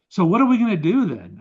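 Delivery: energetic turn to solutions; redirecting. Retold or imitated. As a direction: pivot them toward action. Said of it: "It's like".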